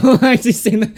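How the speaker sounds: giggling it out; laughing. Real, not acted. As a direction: crack up mid-line. Laughing at own joke.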